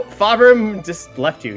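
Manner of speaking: usual speaking voice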